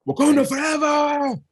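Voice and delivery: high, slow, loud battle cry; rousing shout. Shown as a demonstration. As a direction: shout it to rally the troops. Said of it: "he could have said it better".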